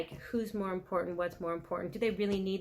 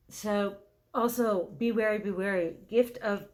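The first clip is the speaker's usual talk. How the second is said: higher, louder and intent; heated warning; 3 semitones higher, 6 dB louder than usual. Real, not acted. Reader cautioning Taurus about the moon, heated and intent. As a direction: press the warning with real urgency. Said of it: "Even my voice got a little high there".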